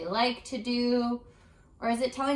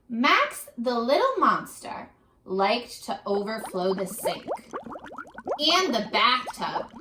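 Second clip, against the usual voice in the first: storybook narration, slower and louder at the end; reading to children; 2 semitones higher, 4 dB louder, slower than usual. Acted. Teacher reading the messy monster part to her class. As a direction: savour the mess for young listeners. Reading picture book aloud.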